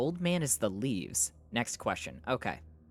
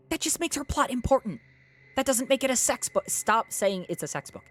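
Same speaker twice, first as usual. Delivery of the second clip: very high, loud protest; exasperated shouting; 12 semitones higher, 4 dB louder than usual. Real, not acted. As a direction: argue back at full exasperated pitch. Arguing with chat over the book.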